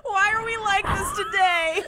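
squealing giddy laugh. Real, not acted. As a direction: laugh through it, high and loud. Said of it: "we're extra giddy today".

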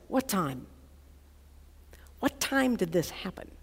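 soft, low, stunned asking; hushed question. Acted. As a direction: ask it quietly, dropped low. Father's hushed question on hearing news.

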